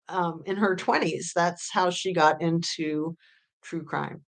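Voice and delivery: ordinary talk, nothing marked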